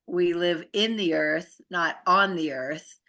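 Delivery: ordinary talk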